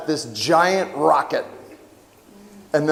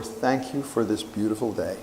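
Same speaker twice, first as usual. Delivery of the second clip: softer, lower, gently spoken; soft last words; 6 semitones lower, 8 dB quieter than usual. Retold or imitated. Father's last words to the family, softer and hushed. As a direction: let it fade like a last breath. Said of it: "His last words were".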